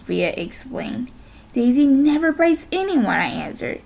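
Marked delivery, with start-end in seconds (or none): 1.5-3.1 protesting. A narrator's reading. Protesting disbelief.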